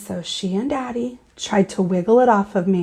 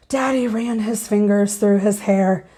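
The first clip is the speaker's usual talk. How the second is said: usual speaking voice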